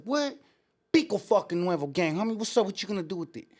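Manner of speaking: high, confrontational comeback; retold gang call-out, daring him; aggressive challenge. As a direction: imitate a friend squaring up to someone. Acts out friend's gang challenge.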